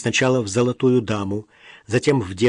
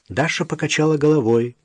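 plain reading voice

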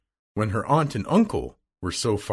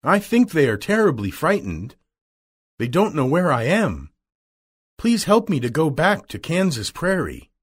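lightly voiced young girl, higher and earnest; pleading girl; 6 semitones higher than usual. Dorothy begs the witch for help home, pleading. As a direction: beg gently to go home.

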